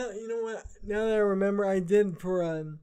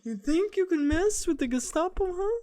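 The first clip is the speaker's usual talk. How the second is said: put-on German accent; 8 semitones higher than usual. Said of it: "the German accent".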